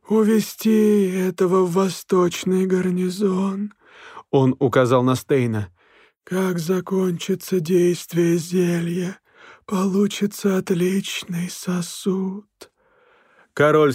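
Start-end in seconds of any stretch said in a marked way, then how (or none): 0.0-3.7 slow, cold, eerie spirit voice; icy otherworldly voice. A narrator's reading. Голос Смергла. 6.2-12.5 slow, cold, eerie spirit voice; icy otherworldly voice. A narrator's reading. Голос Смергла.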